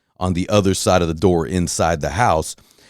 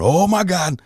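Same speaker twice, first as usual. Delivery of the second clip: pitched-up panicked cry at contained volume; retold yell; 11 semitones higher than usual. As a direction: reenact crying out under roaring engines. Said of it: "I couldn't hear my own voice".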